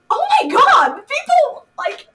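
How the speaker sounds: high, loud, laughing disbelief; overwhelmed. Retold or imitated. Actor reacting to the viral video, high, loud and laughing. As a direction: squeal in giddy shock. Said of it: "And I'm just like".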